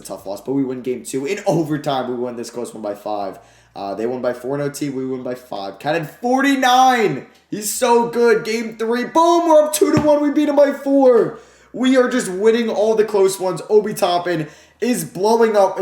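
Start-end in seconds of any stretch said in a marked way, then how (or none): 5.8-15.8 high, loud, pumped call; hyped up. Real, not acted. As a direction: celebrate every win out loud. Winning close playoff games.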